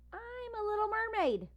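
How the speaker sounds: high, gleeful little voice; playful child. Acted. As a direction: sing it out like a happy kid. Young Ruby playing in water.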